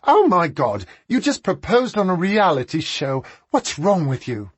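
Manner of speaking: high, loud shriek of horror. A narrator's reading. Sister sees the cameras rolling and shrieks, high and loud. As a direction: shriek it in shock. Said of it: "and shrieked".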